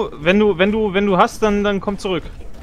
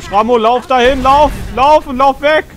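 panicked shout, higher and louder; being chased; 8 semitones higher, 6 dB louder, slower than usual. Real, not acted. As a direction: yell at a teammate to run. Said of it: "da verfolgt dich einer".

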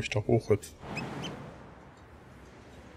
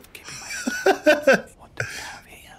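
breathy laugh. Real, not acted. Laughing at the game.

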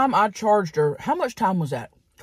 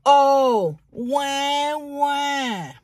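mocking crybaby wah; 3 semitones higher, much slower than usual. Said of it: "Dime looks over and goes".

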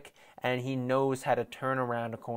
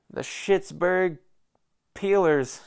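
mocking nickname; 5 semitones higher, 5 dB louder, slower than usual. Real, not acted. Mocking nicknames for rival Steelers.

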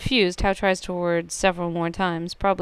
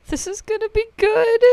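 gleeful; 5 dB louder than usual. Real, not acted. Gleeful at the prank.

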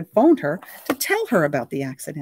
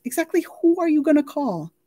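amused; 12 semitones higher, 4 dB louder than usual. A narrator's reading. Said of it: "She looked amused by the request".

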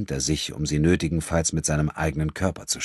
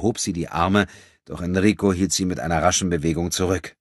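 ordinary delivery

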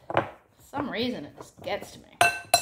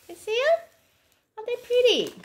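much higher, louder showing-off voice; proud delight; 13 semitones higher, 7 dB louder, much faster than usual. Real, not acted. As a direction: show them off, delighted. Proudly showing finished treats.

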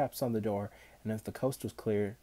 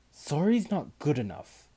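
raised, cross reprimand; stern scolding; 5 semitones higher, 6 dB louder than usual. A narrator's reading. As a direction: tell them off loudly and sharply. Manfred scolds Olivia.

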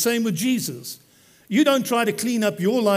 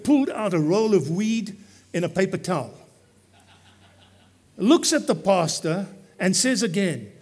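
ordinary talk

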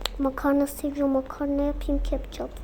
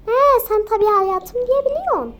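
angry retort; 7 semitones higher, 6 dB louder than usual. Acted. Wife snapping at husband.

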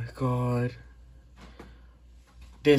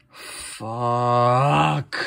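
a real cry of defeat; frustrated groan; 3 dB louder than usual. Real, not acted. Gamer giving up on the bad encounter, defeated groan. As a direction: let it out like a beaten groan. The rare catch went bad.

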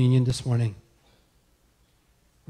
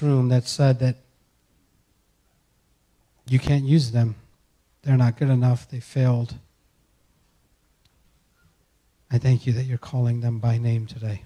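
pastoral prayer; 2 semitones lower than usual. Acted. Pastor praying over the congregation, earnest and building. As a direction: pray it with rising fervour.

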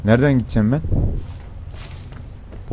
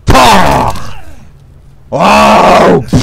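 loud high whoop of delight; excited whoop; 9 semitones higher, 9 dB louder than usual. Real, not acted. Gamer thrilled by his axe hit, loud high whoop.

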